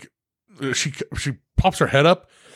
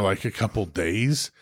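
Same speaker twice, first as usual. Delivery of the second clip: usual speaking voice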